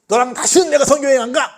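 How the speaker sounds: preacher playing Paul refusing Mark, furious shout; angry shout. Yell it like slamming the door on a friend. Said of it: "처음에는".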